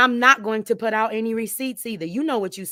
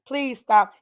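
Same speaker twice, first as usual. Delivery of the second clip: ordinary talk, nothing marked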